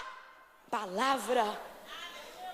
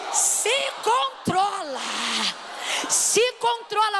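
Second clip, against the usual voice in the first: slow, firm, higher; firm command; 5 semitones higher, much slower than usual. Shown as a demonstration. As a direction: tell her firmly to hold herself together. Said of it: "é três, vai". (ordem firme e pausada)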